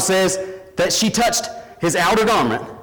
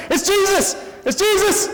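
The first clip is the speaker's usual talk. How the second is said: much higher, excited calling out; excited crowd cries. Acted. Crowd pressing around Jesus, calling his name excitedly. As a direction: cry out like a thrilled crowd.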